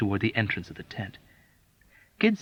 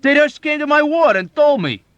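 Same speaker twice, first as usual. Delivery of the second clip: high, loud gleeful news; rejoicing; 8 dB louder than usual. A narrator's reading. Fellow patient gleefully sharing good news. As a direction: bubble over with glee.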